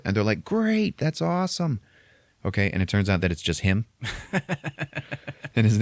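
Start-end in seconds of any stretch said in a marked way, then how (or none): 0.4-1.8 much higher, gushing; high-pitched fake cheer; mock delight. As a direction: squeal it like thrilled coworkers. Coworkers delighted by DJ offer.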